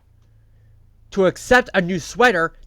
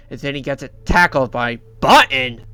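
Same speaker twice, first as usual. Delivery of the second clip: ordinary delivery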